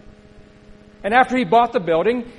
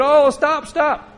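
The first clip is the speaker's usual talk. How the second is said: higher, louder mock plea to stop; retold family crying out, higher and louder; calling out stop; 6 semitones higher, 4 dB louder, much slower than usual. Family shouting at window-breaking grandfather.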